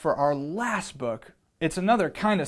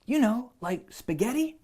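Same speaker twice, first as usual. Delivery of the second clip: put-on higher boy's voice; the boy asks the lion for spaghetti, in a higher voice; eager boy voice; 7 semitones higher than usual. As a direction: ask brightly like a hopeful boy. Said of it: "I asked".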